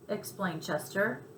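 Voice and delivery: ordinary delivery, nothing marked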